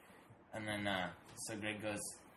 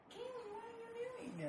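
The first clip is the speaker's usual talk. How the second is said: very high, slow, thin cooing; mock pet-talk; 5 dB quieter, slower than usual. Imitating someone fussing at the cat, high slow pet voice. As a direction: squeak slowly at the cat.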